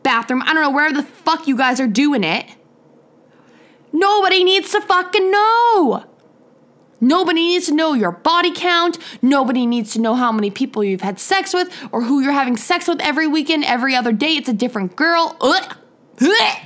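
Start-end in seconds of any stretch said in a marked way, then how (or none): 3.9-9.0 raised, emphatic angry cry; exasperated outburst. Real, not acted. Podcaster tells listeners to keep sex lives private, exasperated. 15.4-16.6 short, higher-pitched "ugh" of revulsion; disgusted retch. Real, not acted. Podcaster revolted by others' sex lives, disgusted gagging. Disgust at hearing of body counts.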